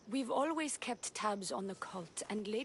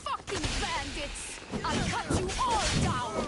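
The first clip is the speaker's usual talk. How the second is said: high, loud combat yell; furious battle shout; 8 semitones higher, 9 dB louder than usual. Acted. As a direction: roar it at the attackers.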